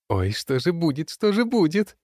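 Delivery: very high, sneering mock worry; mocking squeal. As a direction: gloat in a high mock fret. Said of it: "Продолжает глумиться лень".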